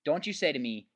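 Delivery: usual speaking voice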